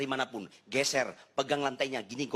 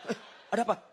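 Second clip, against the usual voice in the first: high-pitched mock-startled question; startled husband; 8 semitones higher, much faster than usual. Husband asks wife what's wrong, mock startled. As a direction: act out jumping at the wife's cry. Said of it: "Eh, kaget saya".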